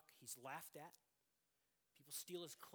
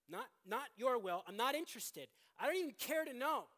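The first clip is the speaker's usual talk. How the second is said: defiant; 5 semitones higher, 9 dB louder than usual. Acted. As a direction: dig in and refuse loudly. Voicing the defiant sinner.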